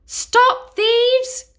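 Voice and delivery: high, loud queen's cry; queen yelling at the thieves, high and loud; royal cry. Acted. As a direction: shriek like an outraged queen. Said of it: "cried a royal voice".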